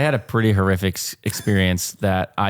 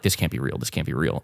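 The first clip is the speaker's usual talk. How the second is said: quiet; 8 semitones lower, 6 dB quieter, faster than usual. Retold or imitated. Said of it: "and she's like".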